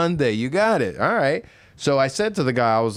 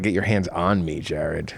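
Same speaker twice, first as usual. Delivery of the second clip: low mock reply; 8 semitones lower than usual. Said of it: "I was like".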